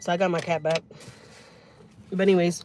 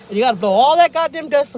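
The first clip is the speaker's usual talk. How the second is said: loud rant; 7 semitones higher, 10 dB louder than usual. Real, not acted. Ranting about nosy guy.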